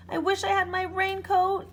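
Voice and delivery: shouting over the storm; yelling. A narrator's reading. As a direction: call it out loudly against the wind. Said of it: "yelled Elmer".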